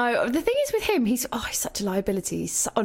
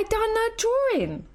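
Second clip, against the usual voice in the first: put-on high, sweet little-boy voice; cute toddler voice; 11 semitones higher than usual. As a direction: play the innocent three-year-old showing off a drawing. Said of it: "he was like".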